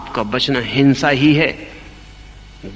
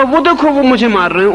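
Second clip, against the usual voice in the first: higher, louder anguished cry pointing across the river; pained cry; 10 semitones higher, 5 dB louder than usual. Retold or imitated. Ramakrishna to the devotees in the boat, a raised anguished cry. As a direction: cry out as if the whip lands on you. Ramakrishna cries out being whipped.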